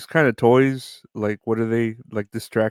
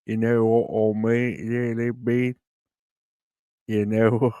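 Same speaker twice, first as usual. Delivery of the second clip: slower, heavier Aussie voice; Australian accent; slower than usual. Shown as a demonstration. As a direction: stretch it out like an Aussie. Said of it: "That's my, uh, Australian".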